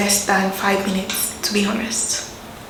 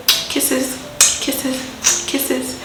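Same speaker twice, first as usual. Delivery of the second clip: higher, softer and fading; blowing kisses; 4 semitones higher, 5 dB quieter, much slower than usual. Acted. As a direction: send sweet kisses to viewers. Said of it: "give my viewers kisses".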